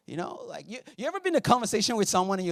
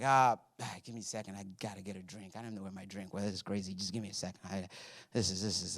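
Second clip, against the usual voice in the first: low, much softer, hesitant stalling; buy time, too rattled to speak; stalling shaken spy; 8 semitones lower, 10 dB quieter, faster than usual. Retold or imitated.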